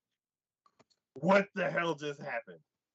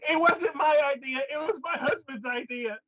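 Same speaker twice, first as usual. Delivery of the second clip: high, loud pleading woman; hammed-up pleading woman; 12 semitones higher, 3 dB louder than usual.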